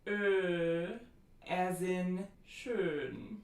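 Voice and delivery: ö held long and tense, as in schön; long rounded vowel. Shown as a demonstration. Teacher introducing a German vowel, slow, long tense ö. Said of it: "This is the long, mid-front, rounded, tense vowel".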